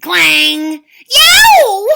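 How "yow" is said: very loud, high yelp of pain; shout. Acted. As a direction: yelp as teeth break. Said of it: "shouted Cucullin".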